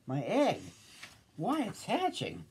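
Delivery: mild storybook shout of discovery; excited shout. A narrator's reading. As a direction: exclaim in rising wonder. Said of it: "shouted Horton".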